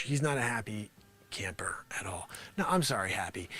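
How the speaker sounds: low whispered aside. Acted. Talking about the grumpy man nearby, in a low whisper. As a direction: whisper behind his back.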